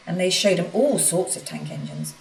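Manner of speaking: ordinary delivery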